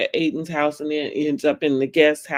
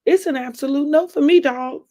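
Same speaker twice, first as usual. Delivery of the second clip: lightly voiced talent-show judge, higher and quick; a hint of a TV judge's verdict, pitched up; higher, brisk mock-judge rejection; 8 semitones higher, 4 dB louder than usual. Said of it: "in my [name] voice".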